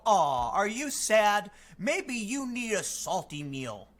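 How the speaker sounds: slow, syrupy fake concern; mock sympathy. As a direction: talk down to them like a sad child. Mocking the ad's fake sympathy.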